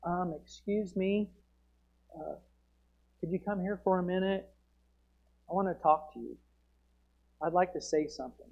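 politely hesitant, like asking permission to interrupt; meek mock politeness with long pauses; timid request. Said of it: "Amos didn't go up and say".

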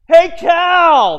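high, very loud yell; full-volume scream. Acted. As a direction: holler across the whole station. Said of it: "I screamed as loud as I could".

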